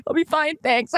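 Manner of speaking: tipsy and teary, acting brave to the waitress; dramatic. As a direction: play the tragic heroine. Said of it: "Like, so dramatic at this point".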